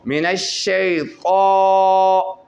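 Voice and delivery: slow loud recited मिनश शैतान; recitation. Shown as a demonstration. Teacher recites for students, slow and loud. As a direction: chant it slowly with a long vowel. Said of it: "ऐसे तिरछा करके पढ़ेंगे".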